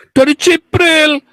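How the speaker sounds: high, slow, drawn-out answer; mock indignant believer. Opponent answers where Muhammad learned it, high mock indignation.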